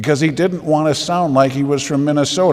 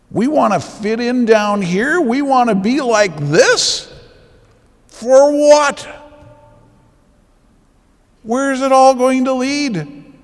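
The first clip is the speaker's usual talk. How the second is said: rising, louder fervent preaching; preaching at full force; 8 semitones higher, 6 dB louder than usual. Acted. Priest warning his parish about the burning world, fervent preaching. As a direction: thunder it at the congregation like a warning.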